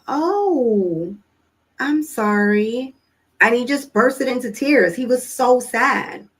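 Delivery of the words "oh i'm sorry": slow, drawn-out consoling; retold sympathy. Mom consoles her son who expected dad, slow and sorry. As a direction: stretch the words out in motherly sympathy.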